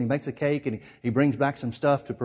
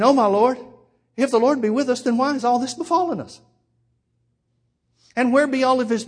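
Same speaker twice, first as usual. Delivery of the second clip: loud, high scripture read in full preaching voice; fiery sermon reading of Gideon's complaint; preaching at full force; 9 semitones higher, 5 dB louder than usual. Acted. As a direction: proclaim the verse up high.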